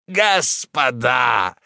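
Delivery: slow, drawn-out joyful shout; rapturous. A narrator's reading. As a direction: call out in delight. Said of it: "с восторгом продолжал".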